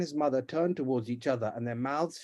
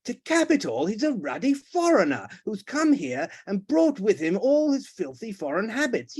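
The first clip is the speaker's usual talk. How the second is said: higher, heated tirade; indignant rant; 7 semitones higher, 4 dB louder than usual. Acted. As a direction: spit out your outrage. The Duke rants about foreigners.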